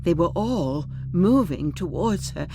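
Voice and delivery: plain reading voice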